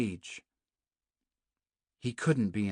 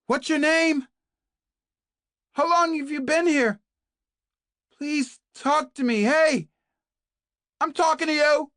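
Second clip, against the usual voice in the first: much higher and louder, shouting to be heard; pleading shout; 10 dB louder than usual. A narrator's reading. Kate shouts to the silent Naomi, pleading yell. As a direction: yell through the walls, demanding an answer. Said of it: "she shouted".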